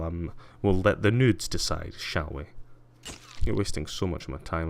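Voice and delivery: usual speaking voice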